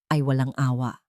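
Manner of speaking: ordinary delivery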